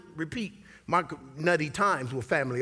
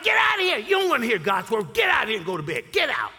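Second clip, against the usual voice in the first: put-on angry dad yelling at laughing kids; retold angry yelling; 7 semitones higher, faster than usual. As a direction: bark them off to bed, hammed up. Preacher retells yelling at his kids.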